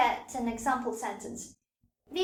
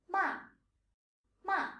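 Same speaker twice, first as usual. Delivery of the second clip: "Ma" starting high and dropping sharply; falling fourth tone; 6 semitones higher than usual. Shown as a demonstration. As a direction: drop "Ma" from the top of the range right to the bottom. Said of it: "It's a falling tone from the higher scale".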